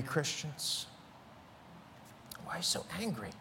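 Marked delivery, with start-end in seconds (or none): 2.4-3.3 half-whispered, much softer question; hushed aside. Acted. Preacher asking angry Christians why, in a hushed voice. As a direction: drop to a near whisper, as if asking privately.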